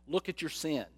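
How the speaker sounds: usual speaking voice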